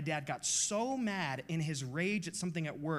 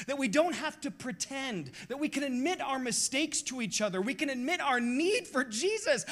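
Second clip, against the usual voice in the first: impassioned preaching, voice climbing high and loud; 8 semitones higher, 4 dB louder than usual. Real, not acted. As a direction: preach it with building fervour. Pastor preaching with rising force.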